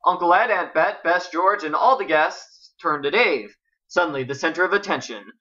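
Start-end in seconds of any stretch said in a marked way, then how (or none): none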